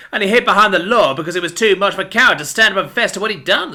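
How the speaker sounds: high, vengeful accusation; unhinged mouse handing down justice; crazed avenger. A narrator's reading. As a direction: rail at the family that wronged you. Billy accuses Scotty's father.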